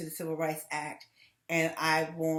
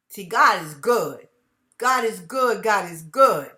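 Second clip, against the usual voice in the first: slow, loud, fervent declaration; loud praise; 9 dB louder, slower than usual. Real, not acted. Vlogger praising God to viewers, loud and slow. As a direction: proclaim it like a testimony.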